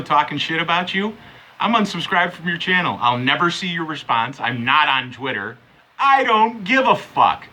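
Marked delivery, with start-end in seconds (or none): 6.0-7.5 higher, louder, slow I don't give a fuck; slow angry shout. Real, not acted. To Mecca, yelling I don't give a fuck, slow and loud.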